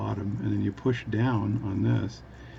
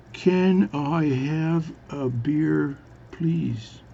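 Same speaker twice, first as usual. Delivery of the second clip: exaggeratedly slow, drawn-out words, pitched up; really slow speech; 5 semitones higher, much slower than usual. Newfie ordering from Spanish bartender, really slowly. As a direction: stretch every word as if for a foreigner. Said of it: "is speak really slowly".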